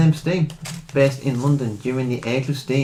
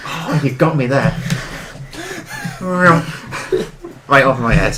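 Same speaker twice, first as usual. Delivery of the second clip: laughing; 2 semitones higher, slower than usual. Real, not acted. Laughing at a missed joke.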